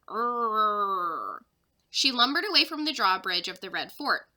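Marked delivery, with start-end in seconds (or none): 0.1-1.4 low rolled elephant rumble; a big animal rumbling; low growling elephant call. Acted. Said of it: "Her rumbling cries grew louder".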